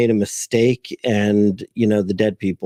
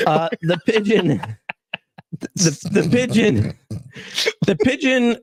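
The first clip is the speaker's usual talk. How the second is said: laughing through words; 7 semitones higher than usual. Real, not acted. Fighting laughter while reading.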